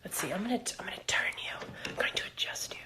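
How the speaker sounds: soft whispered aside while fiddling; hushed, absent-minded muttering; whispering to self. Real, not acted. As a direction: whisper to the thing you are adjusting. Murmuring to equipment while adjusting it.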